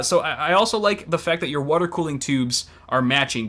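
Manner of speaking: ordinary delivery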